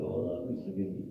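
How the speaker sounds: hushed, low mock weeping plea; pretend crying. Shown as a demonstration. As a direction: fake a tearful prayer, quick and soft. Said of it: "You pretend as if you're crying".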